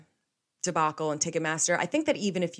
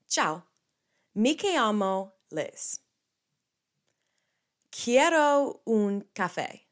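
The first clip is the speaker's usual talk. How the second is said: slow, raised, drawn-out foreign phrases; careful language practice; 4 semitones higher, slower than usual. Shown as a demonstration. Showing listeners her Italian, slow and careful. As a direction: stretch each word like a beginner reciting.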